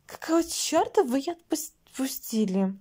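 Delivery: mild yell, starting high and dropping; high-pitched outburst that quickly settles; angry cry. A narrator's reading. Lili demands why they let her go, mild angry cry. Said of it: "крикнула Лили".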